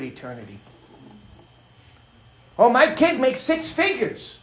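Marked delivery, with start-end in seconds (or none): 2.6-4.4 high-pitched mock boasting; excited bragging parent. Parent bragging about a child's income, mock excited. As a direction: gush like an overexcited parent. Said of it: "parents get all excited about things".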